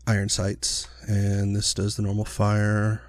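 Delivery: ordinary talk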